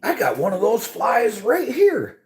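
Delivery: high, prissy mock of the fisherman; mocking falsetto impression of the guy. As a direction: sneer at him in a high, silly voice. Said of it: "And he's like".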